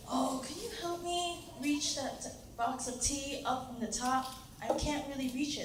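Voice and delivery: soft imitation of an elderly lady; sweet old lady. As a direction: ask softly, like a sweet senior customer. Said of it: "really sweet senior lady".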